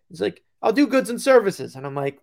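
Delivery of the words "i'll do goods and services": high, loud mock Italian accent; hammed-up Italian-accented reply. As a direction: imitate an Italian buyer, pitched up. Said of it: "strong Italian accent".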